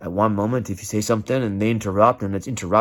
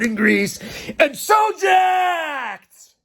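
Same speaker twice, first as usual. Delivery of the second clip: higher, slow, hammed-up shout; mock hyped shout; much slower than usual. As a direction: bellow it like a pumped-up gym guy. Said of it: "So then you're like".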